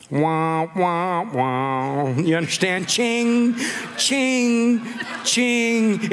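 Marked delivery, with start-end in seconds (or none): 0.1-2.1 drawn-out imitated "wah wah" theme; western showdown sting. Preacher scoring the showdown at the tomb, imitated western sting. 2.9-6.1 evenly spaced, ringing "ching" steps; imitated jingling spurs. Preacher setting up high noon at the tomb, imitated spurs.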